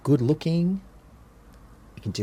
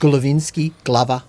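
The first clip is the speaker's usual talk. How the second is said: louder mock officials; 3 semitones higher, 8 dB louder than usual. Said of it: "So they go".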